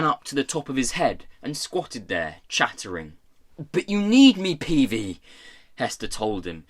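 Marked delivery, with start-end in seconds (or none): 3.7-5.2 higher, louder urgent appeal; desperate plea. A narrator's reading. Prisoner begging the pirate boss, desperate. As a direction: plead desperately, pitching up at the start. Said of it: "forgetting all about Tom in her desperation".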